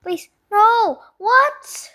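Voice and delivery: high panicked begging; panicked plea. Real, not acted. Chicken attacks, voice rises.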